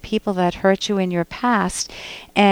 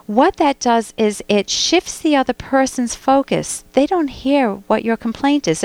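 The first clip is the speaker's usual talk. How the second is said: ordinary talk, nothing marked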